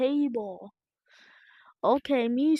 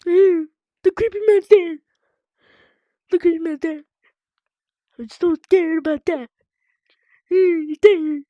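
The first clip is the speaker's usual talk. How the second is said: scared; 3 semitones higher, 10 dB louder than usual. Real, not acted. Scared of creepy man in game.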